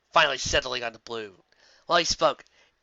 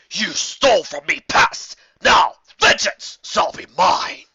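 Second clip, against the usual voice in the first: loud, slow booming threat; roaring dragon; 5 semitones higher, 10 dB louder, slower than usual. Acted. Dragon roaring vengeance at the balloon thieves, booming. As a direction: bellow vengeance down at them. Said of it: "he shouted".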